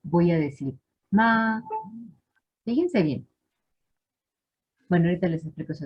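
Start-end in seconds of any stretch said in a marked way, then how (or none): 1.1-1.6 "ma" held high and level; first tone. Shown as a demonstration. Teacher showing the class the first tone, high level "ma". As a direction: sing out "ma" on one steady high note. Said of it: "Si yo lo pongo en primer tono".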